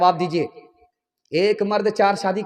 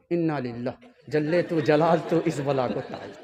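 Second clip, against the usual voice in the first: mock despair; 5 semitones lower, 6 dB quieter than usual. Daughter groans about mother-in-law.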